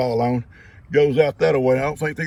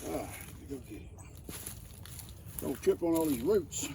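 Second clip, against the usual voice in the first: ordinary talk, nothing marked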